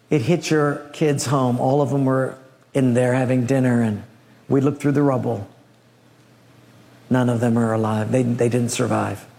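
low, grave report; somber messenger. Acted. Messenger telling Job his children died, low and grave. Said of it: "comes in somber, looking very down".

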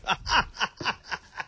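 high-pitched burst of laughter; laughing. Real, not acted. Radio host joking about rising property values, laughing.

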